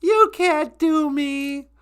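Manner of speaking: very high, slow squeaky mimic; mocking Natasha. Teasing Natasha by imitating her, very high and slow. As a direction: stretch it out in a tiny high mock voice. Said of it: "I can do a pretty good Natasha".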